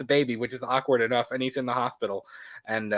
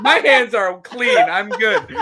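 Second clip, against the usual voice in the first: high, slow, laughing; laughing mock Loomis; 7 semitones higher, 5 dB louder, slower than usual. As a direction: giggle through Loomis's excuse.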